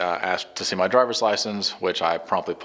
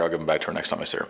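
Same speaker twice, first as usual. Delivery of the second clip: rapid, lower throwaway delivery; talking really fast; 4 semitones lower, 4 dB quieter, much faster than usual. Real, not acted. Storyteller hurrying through an explanation about left cigarettes, talking fast. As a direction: rush through it quickly. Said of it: "I'm talking really fast".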